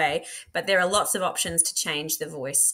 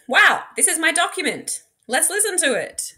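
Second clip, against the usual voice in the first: much higher and louder, bright wow; excited reveal; 10 semitones higher, 7 dB louder than usual. Acted. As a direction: announce it like a thrilling surprise for the class. Excited reveal of uploaded document.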